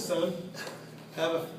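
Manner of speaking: understated, low formal father; father to son, man to man. Retold or imitated. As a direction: say it low and sober, like a dad shaking hands. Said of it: "like a man and he says".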